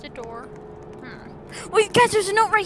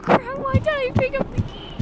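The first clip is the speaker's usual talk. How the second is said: scared horror game streamer; 7 semitones higher than usual. Real, not acted. Panicking after picking up key.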